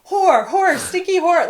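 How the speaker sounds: high, loud teasing call across the room; playful calling out. Retold or imitated. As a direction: shout a friendly insult across the shop. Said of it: "call across the place".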